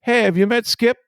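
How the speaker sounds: mock-friendly manager imitation; Ozzie Guillen. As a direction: call out cheerfully, hammed up. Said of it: "And he goes".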